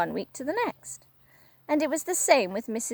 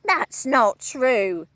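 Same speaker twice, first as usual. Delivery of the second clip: put-on slow, louder protesting old woman; Missus Twit crying out; 5 dB louder, much slower than usual. Wife denying the charge, dismayed cry. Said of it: "cried Missus Twit".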